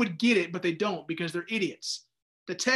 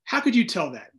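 ordinary delivery

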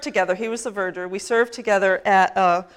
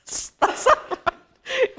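laughing at a slip. Real, not acted. Pastor laughing at her own word mix-up. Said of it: "my internment".

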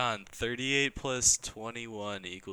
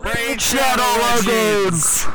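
loud, slow, high promo shout; hyped ad announcer; 9 semitones higher, 11 dB louder, slower than usual. Acted. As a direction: sell it like a cheesy YouTube ad. Fake sponsor announcement.

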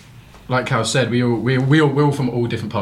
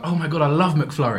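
gushing, higher-pitched mock message; excited fan quote; 7 semitones higher than usual. Bandmate retelling his autocorrected text message, mock excited. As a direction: read the garbled text with fan enthusiasm. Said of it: "But obviously autocorrect, I was like".

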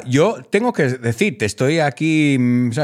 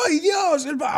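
squealing high ay Dios; light up at the name, voice jumping high; mock delighted squeal; excited recognition; 13 semitones higher, 3 dB quieter than usual.

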